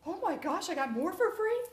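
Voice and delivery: high, thrilled squeal of delight; excited hostess. Retold or imitated. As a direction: gush like she can't believe her luck. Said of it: "she's like".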